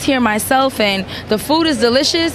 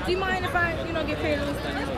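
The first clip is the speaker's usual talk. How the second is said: much softer, quick cheeky murmur; quiet joking aside; 2 semitones higher, 13 dB quieter, much faster than usual. Real, not acted. Host jokes to the tea ladies about pay, softly. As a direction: slip in the joke under your breath.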